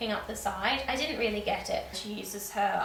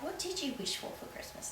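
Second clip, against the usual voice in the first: soft question; 5 semitones higher, 12 dB quieter than usual. Retold or imitated. Funkle asks softly.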